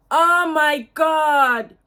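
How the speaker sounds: loud, slow, awestruck oh my God; thrilled oh my God. Real, not acted. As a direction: let the delight burst out, stretched. First sniff of the perfume.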